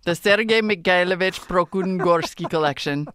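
put-on heavy Russian accent, rolling the names. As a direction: read it like a Russian announcer. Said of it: "test my Russian accent".

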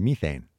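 low, quiet "methane" as MEE-thane; "methane" the BBC way, first syllable like "me"; BBC pronunciation. Retold or imitated. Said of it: "I heard the B B C this morning".

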